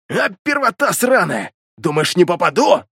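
taunting archer. Acted.

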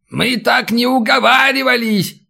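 high, indignant holler from below; outraged old man, acted; old man's yell. A narrator's reading. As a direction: yell up in protest, voice pitched high. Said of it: "заорал снизу старик".

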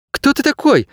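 put-on very high, startled girl voice; blurted question. A narrator's reading. Girl backing away from a talking dog, blurting. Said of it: "выпалила девчонка".

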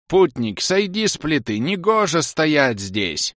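high, slow cracked doll voice; talking doll. A narrator's reading. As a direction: creak it out slowly like a broken doll. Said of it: "выговорил треснутый голос куклы".

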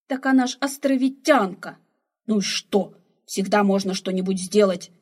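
put-on loud, heated old man; a loud, stubborn retort; heated insisting. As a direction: argue hotly for your plan. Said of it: "горячился старик".

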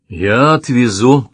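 ordinary delivery

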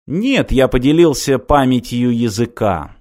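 usual speaking voice

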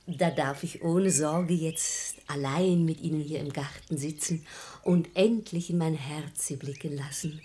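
hushed seduction. Acted. Wife feigns seduction in garden.

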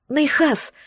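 a high squeal of his name; high, joyful squeal. A narrator's reading. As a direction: squeal with joy as he walks in. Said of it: "взвизгнула я".

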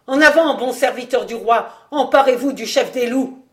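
loud call to arms; rally the soldiers loudly; commanding shout. Acted. Ordering soldiers to attack.

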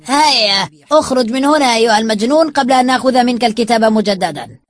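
loud, high order to get out; commanding, threatening yell; shouted dismissal. Acted. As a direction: shout him out of the room. Angrily ordering him out.

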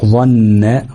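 ظن with a lengthened nasal ن; held ghunna. Shown as a demonstration. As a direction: hold the doubled ن of ظن. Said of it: "هنتك على النون شوية". (ظن بغنة ممدودة)

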